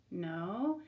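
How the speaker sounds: normal talking voice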